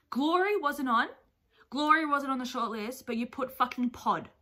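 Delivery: loud, indignant complaint; worked-up disbelief turning to annoyance; getting angry. Real, not acted. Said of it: "why am I angry".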